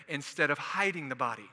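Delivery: ordinary delivery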